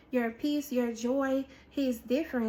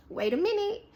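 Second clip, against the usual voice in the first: anxious hesitation; 6 semitones higher, faster than usual. Retold or imitated. Acts out anxiety at being released.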